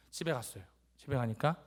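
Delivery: ordinary delivery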